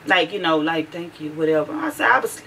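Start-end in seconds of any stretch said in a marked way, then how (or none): 0.9-1.3 very soft, low murmur; whispering. Retold or imitated. Imitating daughter answering a compliment, whispering. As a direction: imitate someone barely getting the words out. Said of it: "talking at a whisper now".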